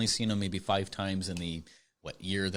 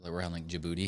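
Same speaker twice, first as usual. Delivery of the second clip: ordinary talk, nothing marked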